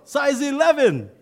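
very high, loud recruit answer; a recruit shouting his size back; shouted reply. Acted. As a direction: yell back to the sergeant.

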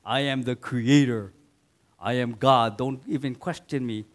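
a mock thundering deity; stern God. Preacher imitating an imagined angry God rebuking. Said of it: "It's like".

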